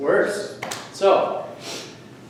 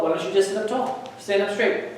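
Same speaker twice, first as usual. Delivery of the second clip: ordinary talk, nothing marked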